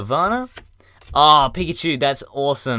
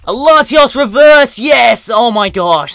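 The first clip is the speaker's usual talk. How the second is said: high, loud joyful yell; excited shout; 11 semitones higher, 14 dB louder, slower than usual. Real, not acted. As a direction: shout with real delight at the pull. Pulls a rare card.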